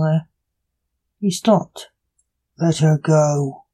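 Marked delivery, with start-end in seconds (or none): 2.6-3.7 slow, lower-toned snarl, understated; snarled command. A narrator's reading. Unseen voice orders the killer to free her, restrained snarl. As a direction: growl the order slowly, without raising volume. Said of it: "voice snarled".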